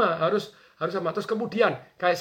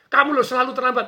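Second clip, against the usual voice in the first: angry boss; 5 semitones higher, 5 dB louder than usual. Retold or imitated. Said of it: "Kalau atasan Anda marah".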